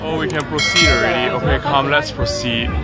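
usual speaking voice

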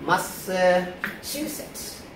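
Massachusetts drawn out very slowly, rising at the end; pronunciation demo. Shown as a demonstration. Teacher showing the class how to pronounce it, slow demonstration. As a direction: say Massachusetts slowly, stretched for learners. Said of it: "how do we pronounce it".

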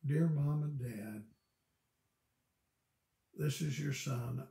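a son politely asking his parents for help; polite humble letter. Retold or imitated. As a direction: read it like a humble, kind request. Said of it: "a lot of meaning in how things are said".

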